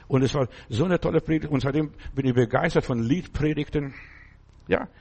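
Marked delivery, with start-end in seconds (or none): none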